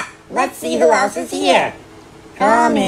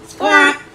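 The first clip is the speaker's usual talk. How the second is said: parrot squawk; 5 semitones higher than usual. Acted.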